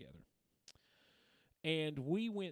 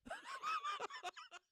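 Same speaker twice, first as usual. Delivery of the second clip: high-pitched, wheezy-light burst of laughter; 5 dB quieter than usual. Real, not acted. Storyteller reaching his student's awkward realisation, laughing. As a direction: let out a high, tickled laugh.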